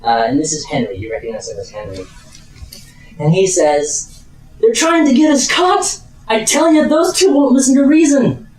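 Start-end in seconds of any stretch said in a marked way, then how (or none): 4.6-8.5 high-pitched, agitated outburst; alarmed colleague. Acted. Colleague running out of a magical duel, high alarmed outburst. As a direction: burst out in exasperated alarm.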